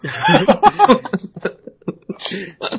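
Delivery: high burst of laughing; sustained high laugh; real laughter. Real, not acted. Laughs at co-host's blunt retort.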